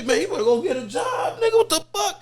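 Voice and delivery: high, loud scoffing advice; mock the go-get-a-job crowd, high and loud; scornful advice. People scoffing at a friend who needs money, high and loud. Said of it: "first thing they gonna tell you".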